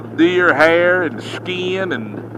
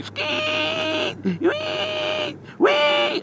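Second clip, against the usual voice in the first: piercing high squeal of a wounded deer; 9 dB quieter, much slower than usual. Retold or imitated. Trucker imitates the hit deer screaming at him, piercing squeal. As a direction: shriek it like a hurt animal. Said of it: "he started yelling at me".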